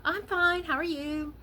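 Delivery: fake happy voice. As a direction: mask a bad day with a sunny chirp. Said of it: "usually we put on a fake voice".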